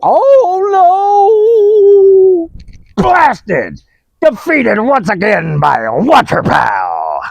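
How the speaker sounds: loud, hammed-up villain wail; defeated villain. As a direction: curse like a beaten cartoon villain.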